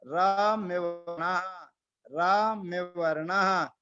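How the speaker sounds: "रम्य वर्णः" slow, syllables separate; correct pronunciation. Shown as a demonstration. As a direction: model "रम्य वर्णः" slowly, twice. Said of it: "इसको रमरी मरना नहीं बोलना है". (सही उच्चारण "रम्य वर्णः" धीरे-धीरे)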